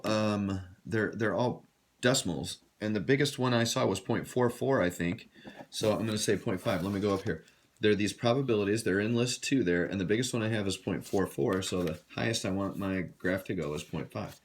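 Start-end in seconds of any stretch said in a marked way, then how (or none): none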